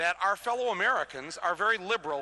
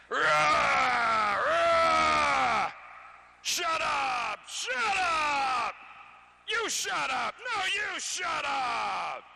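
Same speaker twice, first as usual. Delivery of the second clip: high, loud mock rant of shouting; hammed-up angry shouting match; 9 semitones higher, 5 dB louder, much slower than usual. Mocking right-wing talk radio hosts, shouting angrily. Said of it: "You know the sound".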